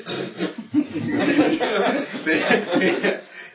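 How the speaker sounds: high, loud imitated laugh in a put-on voice; mock laugh. Retelling his answer to the beginner with a laugh. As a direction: laugh it off as the reply to her. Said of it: "I sort of said".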